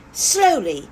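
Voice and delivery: ordinary delivery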